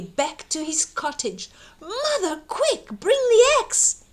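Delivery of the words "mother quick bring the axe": high, urgent call for help; urgent shout. Acted. Jack racing home calling to mother, urgent shout. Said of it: "he shouted".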